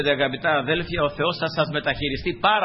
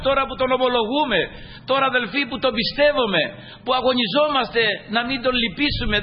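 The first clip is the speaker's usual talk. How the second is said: raised, higher-pitched sermon voice; fervent preaching; 6 semitones higher than usual. Real, not acted. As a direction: preach it insistently, hammering each phrase. (φλογερό κήρυγμα με υψωμένη φωνή)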